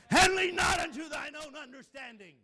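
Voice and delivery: high, very loud preacher's yell; sudden yell. Shown as a demonstration. Preacher startles the congregation mid-verse, sudden high yell. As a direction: bellow the verse to shock the room. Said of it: "do it just like I did it".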